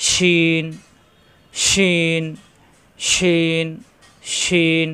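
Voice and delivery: শিন with a strong sh and long vowel; mid-tongue to palate, drawn-out শিন; hushing palatal sh. Shown as a demonstration. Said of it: "জিহ্বার মাঝখানে উপরে তালুতে চাপ দিয়ে".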